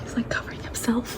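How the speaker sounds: soft hiding whisper. Real, not acted. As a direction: whisper it under your breath. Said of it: "whispering while hiding".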